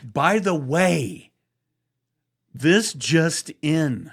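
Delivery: slow, loud announcer voice; mock newsflash, drawn out; breaking news.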